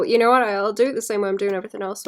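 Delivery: usual speaking voice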